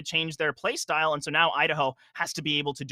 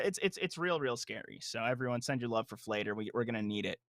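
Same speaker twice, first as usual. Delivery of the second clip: low, soft spooky voice; scary voice; 5 semitones lower, 9 dB quieter than usual. Pretend. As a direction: drop into a hushed spooky tone. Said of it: "That is pretty, that is a pretty scary voice".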